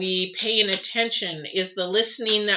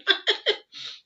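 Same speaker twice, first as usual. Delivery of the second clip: breathy laugh; 13 semitones higher than usual. Real, not acted. Embarrassed at eating on camera.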